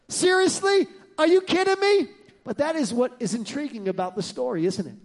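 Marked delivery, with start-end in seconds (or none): 0.1-2.1 high, incredulous objection; mock disbelief. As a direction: play the outraged listener.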